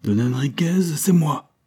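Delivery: put-on young man bursting from hiding, rising high; crying out. A narrator's reading. Gilles leaping out at the monk, crying out. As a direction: cry out his name as he leaps forward. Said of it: "en s'écriant".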